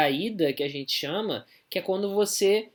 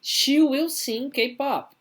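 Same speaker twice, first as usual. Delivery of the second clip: model sentence; 7 semitones higher, much slower than usual. Shown as a demonstration. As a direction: sing it out high and slow for the class. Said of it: "Olha de baixo aqui".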